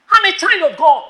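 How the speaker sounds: high, loud declaration; firm rebuke. Shown as a demonstration. Preacher showing girls how to answer a boy, loudly. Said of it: "Tell that boy like that".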